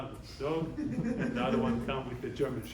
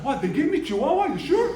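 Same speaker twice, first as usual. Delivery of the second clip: much higher and louder with a laugh; mock shock; 12 semitones higher, 10 dB louder than usual. Man caught with a Chihuahua, faking big surprise. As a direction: play dumb with hammed-up astonishment.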